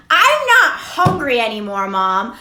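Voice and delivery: mock exasperated son; whiny mock protest. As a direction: whine at mom like a teenager. Retold reply to nagging mom.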